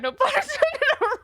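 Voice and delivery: high, loud, slow giggle on the words; laughing aside. Real, not acted. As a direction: chuckle it at your own pun. Laughs at own pun.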